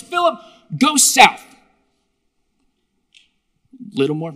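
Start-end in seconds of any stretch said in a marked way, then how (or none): 0.0-1.4 lightly acted angel, raised pitch and louder; angel giving orders. Angel sending Philip south, raised commanding call. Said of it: "He goes".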